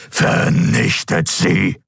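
slow, distorted imperial order; commanding distorted voice. A narrator's reading. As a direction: give the order slowly, voice distorted. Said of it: "befahl der Kaiser". (befehlende, verzerrte Kaiserstimme)

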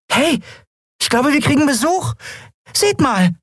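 very high, eager call; excited alert. A narrator's reading. Nelen alerts the crew to a visitor, excited call.

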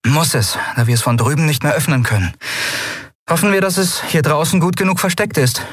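a lightly voiced captain hurrying her crew; captain Endriel. A narrator's reading. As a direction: rush the plan out with eager resolve. Endriel's voice, held.

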